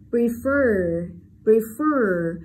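Prefer with a loud, long er in the second syllable; stressed long schwa. Shown as a demonstration. Teacher shows stressed vowel, prefer with long er. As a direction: lean on the final ER of "prefer". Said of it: "âm ơ nhận trọng âm".

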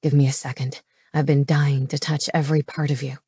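low man's voice, intimate; low-voiced romantic hero; male lover. A narrator's reading. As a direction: drop the pitch, tenderly asking for patience.

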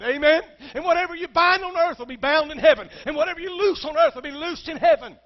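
loud, high, building sermon climax; preaching at full force. Acted. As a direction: drive it home to the pews.

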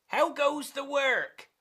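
high-pitched farmer's call. Acted. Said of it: "called the farmer".

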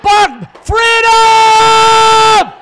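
huge, high, drawn-out Scottish yell; Scottish dog battle cry. Acted. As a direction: bark into a long Scottish war cry. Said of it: "he even barks in Scottish".

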